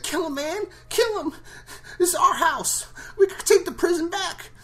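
very high-pitched frantic squeal; Kevin Hart impression. Convict urges ally to kill Rick, high frantic squeal. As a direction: squeal it urgently, far above your own voice.

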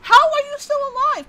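high, loud shout of disbelief; incredulous shout. Real, not acted. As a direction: yell at the undying zombie. Zombie won't drown.

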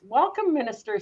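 usual speaking voice

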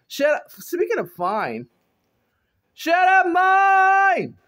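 high, loud playful yell; mock shout. Vlogger jokingly tells laughing viewers to shut up, playful shout. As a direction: yell it in jest.

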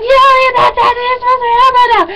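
very high, frantic mock whine; exaggerated high-pitched protest; anxious outburst. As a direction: squeal in impatient panic, hammed up. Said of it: "I was so anxious".

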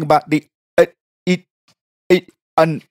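prank caller voice broken into fragments; phone cutting out. Acted. Caller faking a bad line to the receptionist, cutting out. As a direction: drop out mid-sentence like a bad connection. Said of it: "your phone keeps cutting out".

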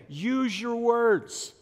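loud, high, drawn-out "use your words"; exasperated parent. Acted. Parent coaxing a crying child to speak, acted exasperation. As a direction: stretch each word out for a small child, mock exasperation. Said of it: "Same thing".

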